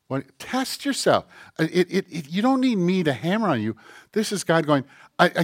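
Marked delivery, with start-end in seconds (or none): none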